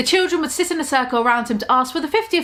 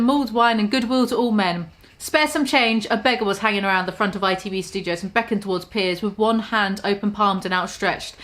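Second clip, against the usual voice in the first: ordinary delivery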